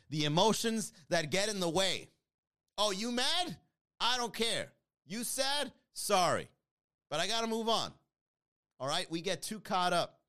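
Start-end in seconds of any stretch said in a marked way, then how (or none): 2.8-7.9 mocking dismissal. Mocking dismissal of emotional people.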